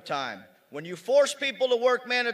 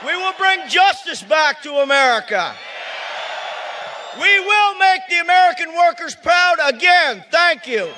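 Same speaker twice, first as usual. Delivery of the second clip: louder and higher with each line, full-throated; rally shout; 5 semitones higher, 5 dB louder than usual. Real, not acted. Union leader rousing the rally crowd, rising to a shout. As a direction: rouse the crowd to a roaring close.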